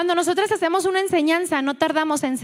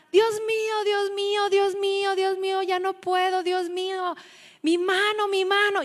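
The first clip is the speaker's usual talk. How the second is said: old woman's retold cry; 4 semitones higher than usual. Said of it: "empieza a gritar la señora".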